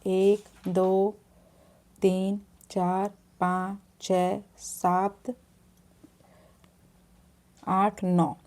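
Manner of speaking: unhurried counting demo, pausing between numbers; counting the letters out slowly for the viewer. Shown as a demonstration. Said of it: "अब आप यहाँ से शुरू करिए".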